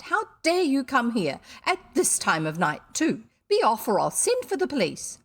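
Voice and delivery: loud, sharp threat to call the police; angry scolding. A narrator's reading. Missus Biddle threatens the children loudly. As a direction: order the brats out.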